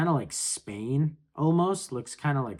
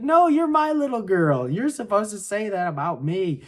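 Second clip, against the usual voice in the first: higher, louder playful mock father; doting dad voice; 6 semitones higher, 3 dB louder than usual. Reacting to the comic, voicing the king to his daughter, mock indignant. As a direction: play the hurt doting king, pitched up. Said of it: "He's like".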